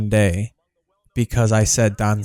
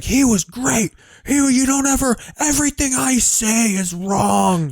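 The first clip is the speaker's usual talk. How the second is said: high, exasperated dad shouting back; furious dad; 10 semitones higher than usual. Retold or imitated. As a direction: play the outraged father, pitched way up. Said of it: "my dad was just like".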